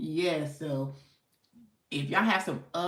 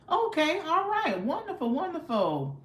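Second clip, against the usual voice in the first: excited; 10 semitones higher than usual. Real, not acted. Host reacts to viewer's answer.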